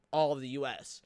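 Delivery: ordinary talk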